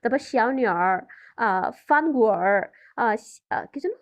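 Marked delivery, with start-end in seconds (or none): 0.2-1.1 শিয়াও নিয়ার closing on a curled r; northern erhua ending. Shown as a demonstration. Teacher giving another northern example, শিয়াও নিয়ার with a curled r ending. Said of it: "এভাবে করে ওরা বলে". 1.9-2.7 ফানগুয়ার drawn out into a curled r; northern erhua ending. Shown as a demonstration. Teacher giving a northern example, ফানগুয়ার with a long retroflex r. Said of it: "এভাবে করে ওরা বলে".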